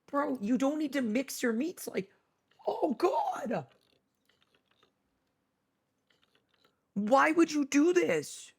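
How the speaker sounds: high, half-laughing protest at the food; appalled. Real, not acted. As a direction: plead in disgust, pitch up and crack into a laugh. Disgusted by bacon-wrapped hot dog.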